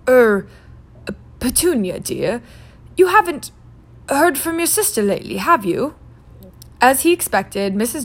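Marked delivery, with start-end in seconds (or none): 0.0-5.9 halting, nervous husband asking; nervous hesitation. Acted. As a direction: tiptoe around a touchy subject. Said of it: "cleared his throat nervously".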